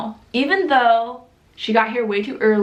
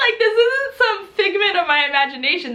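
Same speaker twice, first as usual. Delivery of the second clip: laughing; 9 semitones higher than usual. Real, not acted. Laughing in disbelief.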